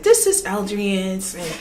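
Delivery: lightly voiced smug lecturer, low and hushed; mock villain speech. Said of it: "He literally sat there and said".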